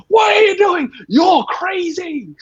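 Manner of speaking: very high, hammed-up shout, drawn out at the end; crazy old-person yelling. Old person scolding diners out during lockdown, hammed-up yelling. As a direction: holler like an outraged old busybody. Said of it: "be the old person to yell at them".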